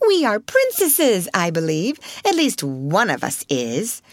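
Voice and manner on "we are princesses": slow, high mock grandeur; sarcastic, drawn-out swank; taunting. A narrator's reading. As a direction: jeer at a rival who plays princess. Lavinia taunting Sarah as princess.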